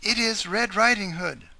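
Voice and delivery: fake girl voice. Wolf pretends to be girl.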